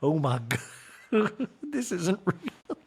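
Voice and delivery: slow, high mock disbelief; hammed-up socialite shock; mock Sutton. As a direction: drawl it like a dazed rich lady. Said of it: "And say".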